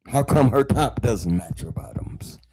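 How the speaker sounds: whispered like a catty churchgoer; a sly whispered dig at church fashion; mock gossip whisper. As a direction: hush it as petty pew gossip. Mocking churchgoers' petty gossip.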